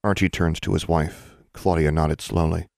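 usual speaking voice

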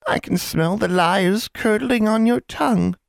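sugary, slow, high hag voice; sickly sweet witch menace; honeyed voice. Acted. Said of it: "said in a deceptively sweet voice".

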